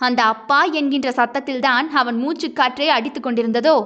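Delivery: usual speaking voice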